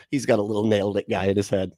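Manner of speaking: ordinary delivery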